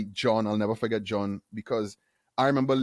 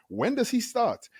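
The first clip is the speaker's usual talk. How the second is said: eager question; 11 semitones higher, slower than usual. Retold or imitated. John asking HR.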